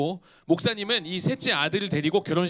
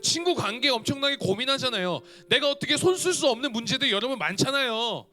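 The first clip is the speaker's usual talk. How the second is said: fervent higher preaching voice; 6 semitones higher than usual. Acted. A preacher urging the youth to lean on God, fervent. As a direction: urge the young listeners, rising fervour.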